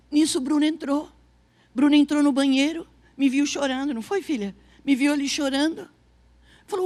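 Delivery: ordinary delivery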